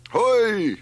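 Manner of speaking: loud, high, drawn-out bellow; roaring. A narrator's reading. Stranger cutting through the uproar, high loud roar. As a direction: roar out over the crowd. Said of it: "roared the voice".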